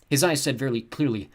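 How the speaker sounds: ordinary delivery, nothing marked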